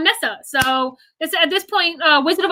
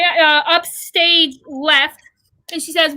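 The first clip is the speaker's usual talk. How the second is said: ordinary delivery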